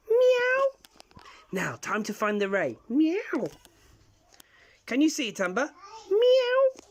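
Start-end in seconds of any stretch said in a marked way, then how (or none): 0.1-1.2 high, loud meow; cat meow. Acted. Cat twin answering the summons, high meow. 6.1-6.8 high, loud meow; cat meow. Acted. Cat twin answering the summons, high meow.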